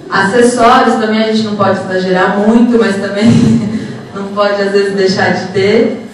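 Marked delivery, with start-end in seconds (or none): none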